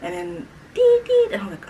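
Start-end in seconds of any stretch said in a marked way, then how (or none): 0.7-1.3 high, loud beeping noise; imitated phone beeps. Imitating the dropped phone's beeps for viewers, high and loud. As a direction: mimic the phone tones sharply.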